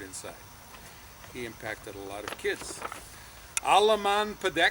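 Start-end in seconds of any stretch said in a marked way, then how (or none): 3.6-4.7 loud, high Swedish shout; Swedish call. Retold or imitated. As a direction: call it out like a ship's order in Swedish. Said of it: "Swedish for all hands on deck".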